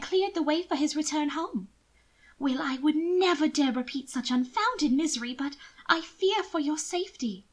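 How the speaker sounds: a worried sister pleading. Acted. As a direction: press the danger on him. Mina fearful confiding.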